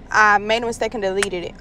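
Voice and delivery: ordinary talk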